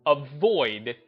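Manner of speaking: usual speaking voice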